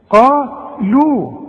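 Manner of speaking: "قا" full and heavy, "لو" thin and light, drawn out; heavy qaf, light lam. Shown as a demonstration. Teacher showing a student heavy then light letters, exaggerated contrast. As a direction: stretch "قا لو" slowly, qaf thick, lam thin. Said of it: "فخم أوي ورقق أوي".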